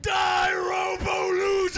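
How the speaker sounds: high loud slow battle cry. Pretend. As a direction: scream it at the enemy. Shouting at enemy.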